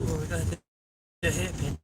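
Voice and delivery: ordinary talk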